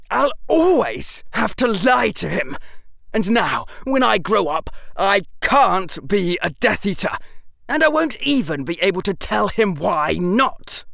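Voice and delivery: bitter and trapped; young Draco voice. Acted.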